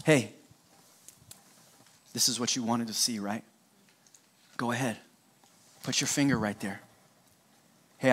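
speaking gently to doubting Thomas; gentle invitation. Retold or imitated. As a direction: coax a doubting friend kindly. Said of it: "He said to Thomas".

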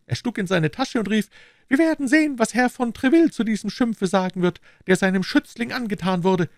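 high, anxious servile voice; bow and scrape with every word; nervous, eager innkeeper; fawning innkeeper. A narrator's reading.

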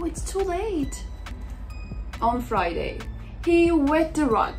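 ordinary delivery

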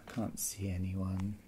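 ordinary talk

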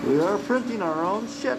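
high, loud cheer on shit; excited. Real, not acted. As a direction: shout it out proudly. Cheering the printing restart.

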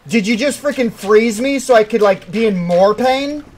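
indignant, high and loud; outraged complaint. Real, not acted. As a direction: protest loudly at the game. Outraged at the game.

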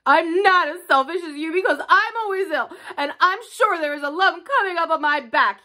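high, pathetic self-pitying complaint. A narrator's reading. Sick Colin feeling sorry for himself to Mary, self-pitying. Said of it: "beginning to feel pathetic and sorry for himself".

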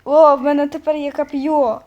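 loud happy cheer; joyful loud win; excited cheer. Real, not acted. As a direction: celebrate the new spear out loud. Gets the spear, celebrates.

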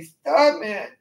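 put-on high whiny baby voice. Shown as a demonstration. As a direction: whine it like a helpless little kid. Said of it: "use a really whiny baby voice and say".

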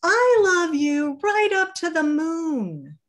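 very high and loud, a final proud claim; high little hare voice. Acted. Little hare to his father before sleep, high proud voice. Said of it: "he said".

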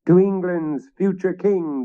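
high, loud toast; toast shout. A narrator's reading. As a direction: raise the toast loudly. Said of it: "they shouted".

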